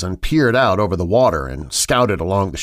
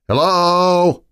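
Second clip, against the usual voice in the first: usual speaking voice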